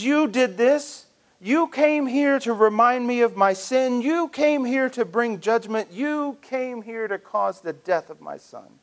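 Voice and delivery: grieving mother accusing the prophet. Acted. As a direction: point the finger with every you. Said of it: "the woman blames Elijah".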